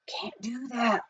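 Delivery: soft hushed aside; whispered. A narrator's reading. Billy softly warning Jack, hushed voice. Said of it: "whispered Pitch Pine Billy".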